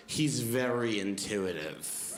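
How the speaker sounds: slow, low, pointed retort; openly aggressive. Retold or imitated. Mother defending her baby to a guest, openly aggressive. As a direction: draw it out as a mock-aggressive put-down. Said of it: "drops the passive part of passive aggressive".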